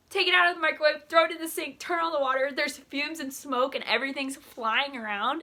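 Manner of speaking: high, loud, panicky account; worked-up storytelling, pitched high; frantic retelling. Retold or imitated. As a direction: rattle off the kitchen emergency in alarm. Retelling frantic kitchen smoke emergency.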